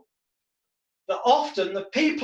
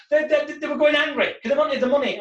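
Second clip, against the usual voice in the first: usual speaking voice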